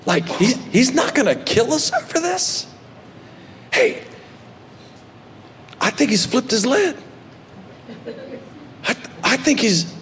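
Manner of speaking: hushed brothers. Retold or imitated. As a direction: whisper it to each other in shock. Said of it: "looking at each other going".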